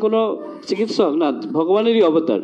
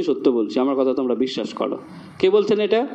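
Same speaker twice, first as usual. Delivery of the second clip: ordinary delivery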